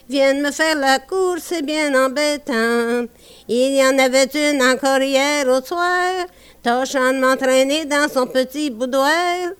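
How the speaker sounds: usual speaking voice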